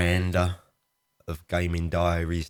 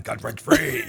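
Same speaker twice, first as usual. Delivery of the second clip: loud, voiceless rasping title roar; hammed-up high raspy announcer; stupid voice; 6 semitones higher, 5 dB louder than usual. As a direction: belt it out as a hoarse, daft trailer voice. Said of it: "I'm doing a stupid voice".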